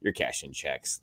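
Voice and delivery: ordinary talk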